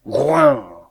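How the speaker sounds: loud, falling animal grunt; big beastly snort; bear grunt. A narrator's reading. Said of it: "le cri des animaux".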